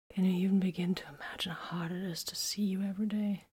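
hushed confession; whispered. A narrator's reading. Vox to Titus, whispered confession. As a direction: confide it in a whisper.

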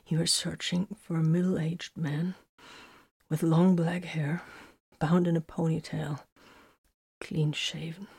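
faint, weak, fading at the end; soft voice dying away; trailing off. A narrator's reading. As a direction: describe it softly, running out of strength. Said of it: "Vox's voice trailed off".

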